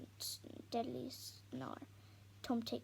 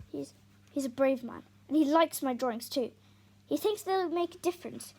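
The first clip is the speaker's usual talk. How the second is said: heated; 2 semitones higher, 13 dB louder than usual. Acted. Tom defends Ryder heatedly.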